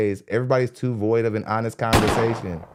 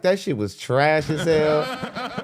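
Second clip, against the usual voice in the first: behind-her-back trash talk; 5 semitones higher, much slower than usual. Retold or imitated. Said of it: "Wait for her to turn her back and be like".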